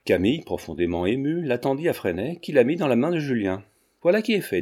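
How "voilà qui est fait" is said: comic gravity. A narrator's reading. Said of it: "avec une gravité comique".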